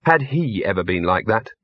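plain reading voice

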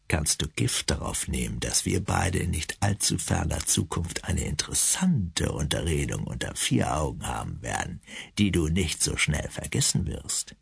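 low, soft, menacing threat; cold menace. Acted. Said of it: "blickte mich kalt an".

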